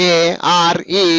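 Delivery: ARE very slow, each letter apart; divided letters. Shown as a demonstration. Teacher showing pupils what not to say, ARE slowly divided. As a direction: break ARE up with gaps between letters. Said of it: "అనరాదు".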